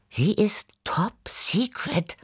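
slow conspiratorial whisper; hushed, drawn-out secret-sharing. A narrator's reading. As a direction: whisper it slowly like a big secret. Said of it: "flüsterte ich".

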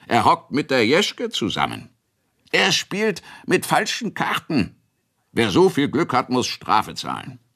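hoch und etwas lauter, stichelnde Witze; spöttelnde Bauern. Acted. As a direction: wie Bauern, die sich beim Kegeln aufziehen. Kegelwitze der Bauern. (spöttische Bauernrunde, höher gesprochen)

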